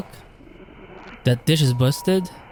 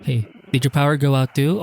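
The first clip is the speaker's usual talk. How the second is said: ordinary delivery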